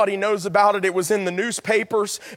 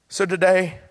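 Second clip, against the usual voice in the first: ordinary talk, nothing marked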